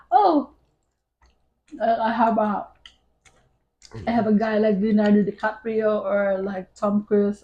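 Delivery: ordinary delivery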